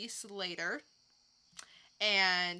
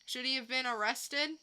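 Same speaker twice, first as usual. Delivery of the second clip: normal talking voice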